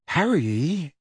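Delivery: tentative, questioning call. A narrator's reading. Girl approaching her silent friend, tentatively. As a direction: feel your way into the name cautiously. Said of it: "said Hermione tentatively".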